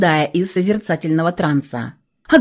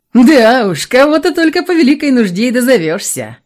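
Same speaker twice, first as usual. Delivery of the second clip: cheeky huffing dragon jab; snorting dragon; 6 semitones higher, 5 dB louder than usual. Acted. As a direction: snort it out with a grin. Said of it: "фыркнула левая голова змея и весело оскалилась".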